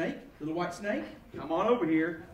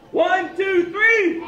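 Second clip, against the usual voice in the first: louder, higher count-off; loud countdown; 4 semitones higher, 5 dB louder, much slower than usual. Real, not acted. Magician counting down for the audience, loud countdown. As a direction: shout the count up to a peak. Big countdown before the shot.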